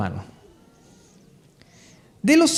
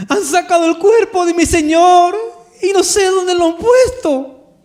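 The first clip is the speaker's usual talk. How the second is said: wailing through tears, much higher and louder; desgarrada llorando; 10 semitones higher, 6 dB louder, slower than usual. Acted. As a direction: cry it out in heartbroken anguish, drawing out the ending. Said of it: "Llorando. Así es la escena. Desgarrada llorando". (llanto desgarrado, a gritos)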